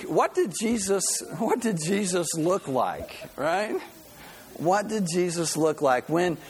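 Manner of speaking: normal talking voice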